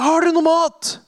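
high, loud call across the water. Acted. Jesus calling from the beach to the boat, loud. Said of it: "og han roper".

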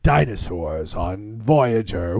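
low, slow trailer announcer; movie trailer voice. Retold or imitated. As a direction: boom it like a trailer. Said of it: "they did a trailer going".

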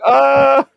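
loud, high drawn-out "ah"; dopey wail. Retold or imitated. Actor mimicking his own stupid-jester audition, dopey high wail. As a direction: let out a loud, high, clueless "ahh". Imitates himself as the clueless jester.

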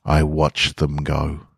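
ordinary delivery, nothing marked

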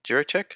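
Jiricek said as CHEER-uh-check, questioning; high, soft CHEER-uh-check for Jiricek, rising; unsure pronunciation. Real, not acted. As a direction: guess at Jiricek with "ch" first and "check" last. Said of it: "I'm gonna butcher this".

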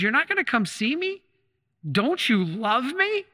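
high, wounded, slowing down on the plea; a stung husband asking if he's loved; hurt disbelief. Retold or imitated. Husband in hospital to his wife, hurt and disbelieving, rising high.

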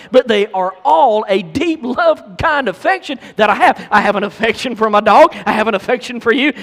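loud, high-pitched preacher's voice; emphatic preaching. Real, not acted. Preacher telling the congregation he loves them, loud and emphatic. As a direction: preach it out with rising force.

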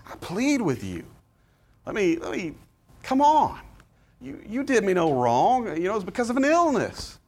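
Paul pleading with his friends; pleading with feeling. Shown as a demonstration. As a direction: beg them gently, with feeling. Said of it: "read that with some feeling".

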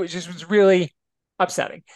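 ordinary talk, nothing marked

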